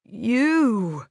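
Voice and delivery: pained groan of a word; drawn-out groaning answer. A narrator's reading. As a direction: groan it out through the pain. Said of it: "he groaned".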